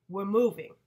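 loud, flat declaration; blunt announcement. Acted. Father announcing the move to the family, loud and blunt. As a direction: drop the news hard.

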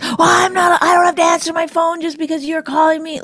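high mock of a defensive partner; hammed-up indignant partner imitation. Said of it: "things been reversed".

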